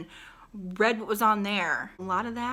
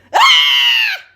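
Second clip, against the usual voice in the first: shrill imitated fox shriek, very high and loud; fox scream; 18 dB louder than usual. Vlogger imitating the fox at the curb, shrieking. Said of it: "and it said".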